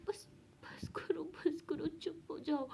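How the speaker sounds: whispered, broken plea to stop; a hint of crying in a whisper; tearful whisper. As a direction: hush her through tears, slowly. Frightened girl crying in story.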